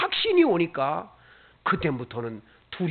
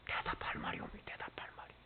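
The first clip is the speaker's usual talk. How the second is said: much softer whispered question; 19 dB quieter, faster than usual. Acted. Preacher whispering to a silent congregation. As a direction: breathe it, barely audible.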